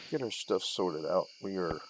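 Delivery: usual speaking voice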